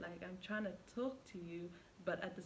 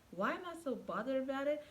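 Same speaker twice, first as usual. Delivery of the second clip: higher, incredulous echo of his question; retold argument; 6 semitones higher than usual. Retelling a fight with her boyfriend, indignant echo. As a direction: fire his own words back at him, indignant. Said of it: "we arguing now".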